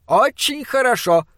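высокий возглас оппонента; воскликни высоко, без крика; театральное восклицание. A narrator's reading. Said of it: "воскликнул фон Хельрунг". (драматично восклицая)

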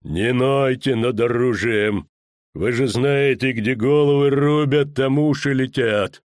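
slow, heavy executioner voice; drawn-out, ominous warning; menacing executioner. Acted. Executioner to the whining official by the block, menacing.

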